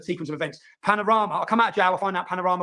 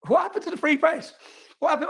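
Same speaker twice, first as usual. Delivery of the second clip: much higher, exasperated demand; indignant outburst; 9 semitones higher than usual. Retold or imitated. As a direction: disbelief thrown back at him. Retelling outraged reply to journalist.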